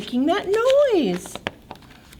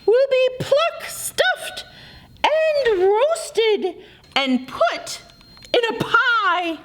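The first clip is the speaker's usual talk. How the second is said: much higher panicked duck; 10 semitones higher than usual. Acted. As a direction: panic over being cooked.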